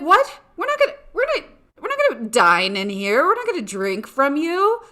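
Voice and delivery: high-pitched, mock-appalled protest; incredulous refusal. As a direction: act out lifeguards aghast at the offer. Disgusted lifeguards refuse the bartender.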